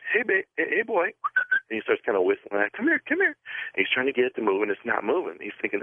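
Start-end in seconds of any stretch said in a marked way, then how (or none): 0.1-1.1 high coaxing call to a dog; high-pitched here-boy call. Retold or imitated. 2.7-3.4 very high sing-song dog call; high coaxing come-here. Retold or imitated.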